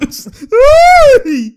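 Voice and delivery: loud, very high shriek; excited shriek. Real, not acted. Commentator reacting to a big play, loud excited shriek. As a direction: let out a long high shriek.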